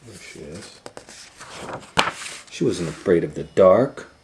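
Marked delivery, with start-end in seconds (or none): none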